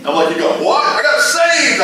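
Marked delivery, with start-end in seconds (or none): 0.3-0.8 high, louder disbelief; can't believe what you heard; incredulous what. Retold or imitated. Disbelief at friend's news. 0.8-1.8 high, slow, drawn-out cry of joy; ecstatic friend. Retold or imitated. Friend repeats his news.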